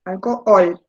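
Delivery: "Alcohol" with a weighted final OL; stress on last syllable. Shown as a demonstration. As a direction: lean on the end of "Alcohol", alco-OL. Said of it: "En la última lleva la sílaba tónica".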